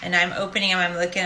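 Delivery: ordinary delivery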